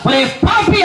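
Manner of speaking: high-pitched accusation; stern preacher's rebuke. Acted. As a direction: call out the sinner sharply. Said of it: "pastor గారు గట్టిగా వాక్యం చెప్తా".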